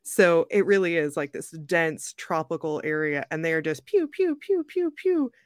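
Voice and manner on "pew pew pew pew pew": high-pitched "pew pew" gun noises; playful rapid-fire shooting sounds; imitated gunfire. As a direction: mimic gunshots up high.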